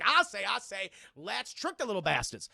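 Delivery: loud, blustering opening with a drawled finish; folksy, hearty rooster impression; Foghorn. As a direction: boom it out like a Southern cartoon rooster. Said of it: "they got Foghorn".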